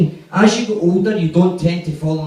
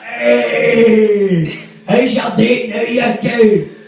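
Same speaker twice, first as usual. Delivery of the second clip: put-on drunk taunt; 6 semitones higher, 3 dB louder, much slower than usual. Drunk friend taunting his date.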